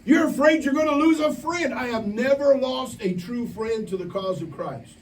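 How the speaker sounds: high, slow, ringing pulpit call; full-force preaching. Acted. Preacher rousing campers at camp, slow high pulpit cry. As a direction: drive it home to the whole room.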